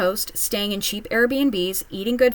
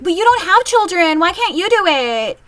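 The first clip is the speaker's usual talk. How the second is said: much higher, louder nagging; mocking family demand; 10 semitones higher, 7 dB louder than usual. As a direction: nag it like family pushing a chore.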